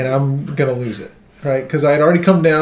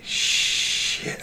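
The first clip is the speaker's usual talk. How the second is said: whispered shit; 10 dB quieter than usual. Retold or imitated. Imagined guy's reaction.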